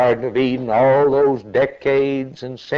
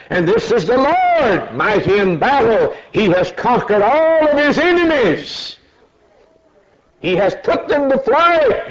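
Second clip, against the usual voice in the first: high ringing preaching; exultant preaching; 10 semitones higher, 3 dB louder than usual. Real, not acted. Preacher reading the psalm, high ringing exultation. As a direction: sing it out in triumph.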